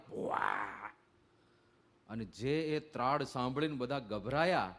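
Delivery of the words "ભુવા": low, growled mock lion roar. Teller roars as the lion to startled youths, low mock roar. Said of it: "એને સીધી ત્રાડ પાડી".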